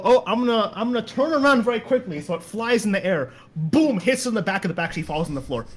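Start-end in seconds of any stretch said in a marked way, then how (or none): 0.0-2.2 mock innocent. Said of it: "I go".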